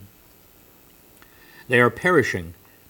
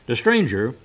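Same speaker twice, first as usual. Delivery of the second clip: usual speaking voice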